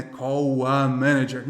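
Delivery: slow Brazilian "Call a", L turned into U; drawn-out Brazilian mispronunciation of "Call"; Brazilian-accented English. Shown as a demonstration. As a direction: say "Call a manager" unlinked, like a Brazilian learner, "cow... a". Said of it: "como muito brasileiro fala um U".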